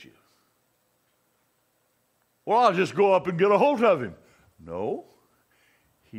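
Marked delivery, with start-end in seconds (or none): 2.5-4.2 higher, louder imagined reply; mock confident answer. Preacher voices someone who'd just go get Jesus, mock confidence. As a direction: toss it out as a naive objector. Voicing a mistaken listener's answer.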